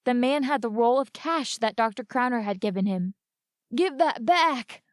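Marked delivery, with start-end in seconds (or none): 3.8-4.8 slow, higher, strained plea; dazed, pleading through pain; groaned. A narrator's reading. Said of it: "groaned Hoyt".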